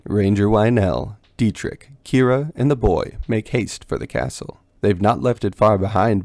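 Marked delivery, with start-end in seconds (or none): none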